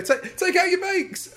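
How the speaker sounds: high, slow mock alarmed call; mock panic. Contestants copying each other in a hammed-up panic. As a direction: urge everyone out in hammed-up panic. Bakers rushing to take bakes out.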